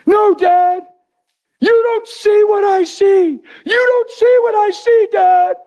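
high, slow, pleading cry; teenage son begging his father to understand; desperate son. Retold or imitated. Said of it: "cried desperately and pitifully".

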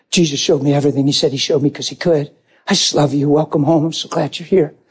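murmured loving reassurance; tender reassurance. Retold or imitated. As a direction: speak low and gently to comfort her. Husband reassures wife in retelling.

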